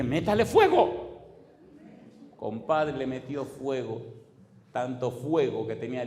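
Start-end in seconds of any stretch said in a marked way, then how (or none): 0.0-1.2 slow, loud, high cry of fire on her; fervent demand. Retold or imitated. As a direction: demand it with a laugh.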